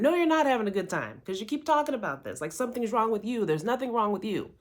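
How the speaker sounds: quick, high imitation of a scolding friend; fed-up contradiction. Friend correcting her about having a good time, fed-up scolding.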